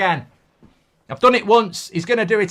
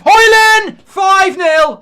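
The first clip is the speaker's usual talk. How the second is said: loud, jubilant goal yell; delighted fan roaring at the fifth goal; goal celebration; 4 semitones higher, 8 dB louder, much slower than usual. Real, not acted. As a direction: scream it out as the fifth goal goes in.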